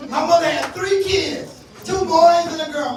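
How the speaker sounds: usual speaking voice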